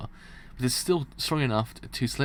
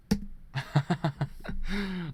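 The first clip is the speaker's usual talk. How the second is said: amused laugh; 5 semitones higher than usual. Real, not acted. Reader laughing at the story.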